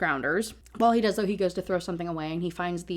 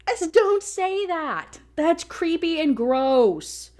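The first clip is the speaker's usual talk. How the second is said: disgusted plea; 9 semitones higher, 4 dB louder, much slower than usual. Real, not acted. Disgusted at the character's line.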